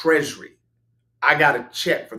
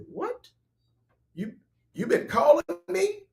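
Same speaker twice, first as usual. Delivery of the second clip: surprised. Retold or imitated. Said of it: "I said".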